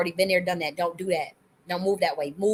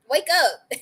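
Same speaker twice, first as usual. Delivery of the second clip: call to wake up; 7 semitones higher, 5 dB louder than usual. Real, not acted. Tells listeners to wake.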